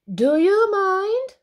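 high, slow, loud protest; drawn-out offended objection; indignant cry. Acted. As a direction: cry out in affronted reproach. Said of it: "cried a herd of buffaloes".

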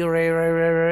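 American English r, loud and retroflex; loud American accent demo of ر. Shown as a demonstration. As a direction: roll ر into a curled American r.

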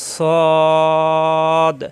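firm, thick model সদ; Arabic letter sad. Shown as a demonstration. Teacher models heavy সদ for the class. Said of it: "জিহ্বা নিচে তাতে চাপ দিয়ে".

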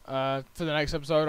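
usual speaking voice

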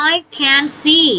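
I can see drawn out word by word; teacher reading an example sentence slowly. Shown as a demonstration. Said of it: "can ตัวนี้แปลว่า".

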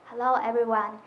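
normal talking voice

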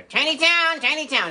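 chirpy puppet parroting; squeaky puppet echo. Acted. Puppet echoes the small hometown, high and squeaky.

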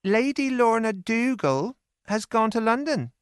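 put-on a young maid breaking news; Lizzie's voice. A narrator's reading. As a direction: servant girl telling sad news. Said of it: "said Lizzie".

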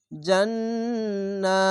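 জান্নাতুন with a long held নাআ and nasal ghunna; slow recitation. Shown as a demonstration. Teacher showing the ghunna, slow recitation. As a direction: recite জান্নাতুন very slowly, stretching the vowel. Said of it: "এক আলিফ টেনে পড়বেন".

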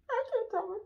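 high-pitched fake sobbing, gasping between words; pretend crying. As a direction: put on a tearful breakdown. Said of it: "pretending like I'm crying".

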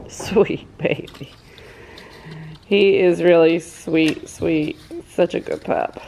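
hushed and tender, almost breathless; tender cooing. Real, not acted. Cooing softly to the puppy.

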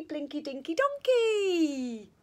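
ordinary delivery, nothing marked

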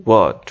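"what" with a rounded o, wot; correct pronunciation. Shown as a demonstration. Teacher offering the right option, correct pronunciation. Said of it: "তাহলে কীভাবে হবে".

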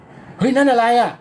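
playing the girl caught off guard; startled cry. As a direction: gasp it out alarmed. Acting her startled reaction.

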